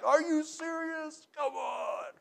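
high, incredulous mock shout; exasperated outburst. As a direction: throw up your hands at the noisy car. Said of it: "that I'm like".

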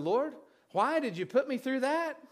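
exasperated plea. Retold or imitated. Preacher retelling his plea to the Lord, exasperated. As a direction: ask God why, half-laughing in disbelief. Retold prayer of frustration after visit.

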